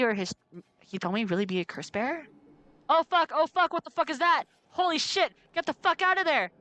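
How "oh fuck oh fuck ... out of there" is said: loud, high panicked swearing; real startled shouting. Real, not acted. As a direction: yell in genuine fright. Startled by a jump scare.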